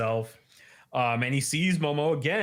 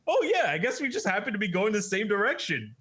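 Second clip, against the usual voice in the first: mock-casual, higher and quick; Okarun; 9 semitones higher, faster than usual. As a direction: play it off like nothing's going on. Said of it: "acting like nothing's going on".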